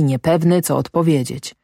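ordinary delivery

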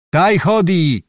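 witch's cry. A narrator's reading. Witch scornfully answers the Cossack, calling out. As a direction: call it out as the witch. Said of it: "zawołała wiedźma".

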